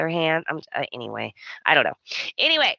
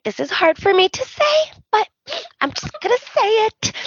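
much higher, put-on teary voice; hammed-up brave girl holding back tears; mock tearful confession; 13 semitones higher than usual. Mocking Taylor's teary speech, high put-on voice. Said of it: "And she's like".

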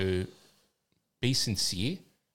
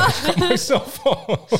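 higher, louder, breaking into laughter; laughing; 7 dB louder, slower than usual. Real, not acted. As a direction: laugh at your own slip. Laughs at own slip.

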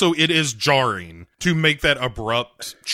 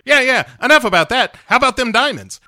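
high, loud mock-American villain; impatient cartoon colonel, pitched way up; Colonel Moon; 9 semitones higher, 4 dB louder, faster than usual. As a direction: brush it off in a high, hammy voice. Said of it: "Moon is like".

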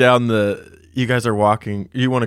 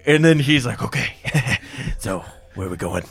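Bothan character voice; 7 semitones higher, 4 dB louder than usual. Acted. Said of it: "he goes".

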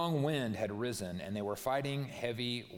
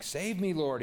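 high, loud cry for rescue; desperate shout to be saved; shouted plea; 6 semitones higher, 4 dB louder, slower than usual. A narrator's reading. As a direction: call out as if sinking. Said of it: "he shouted".

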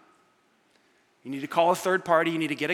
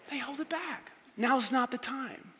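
hushed; 5 semitones higher, 9 dB quieter, slower than usual. Acted. Hushed advice.

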